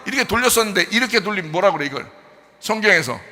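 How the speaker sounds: rising, loud sermon delivery; fervent preaching. Acted. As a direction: drive the point home to the congregation. Preaching at full force. (열정적인 설교)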